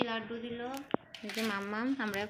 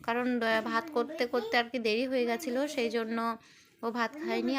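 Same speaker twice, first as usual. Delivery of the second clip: usual speaking voice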